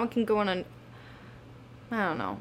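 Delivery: fading out, losing the thought; trailing off. Real, not acted. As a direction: let the words die away unsure. Losing her train of thought.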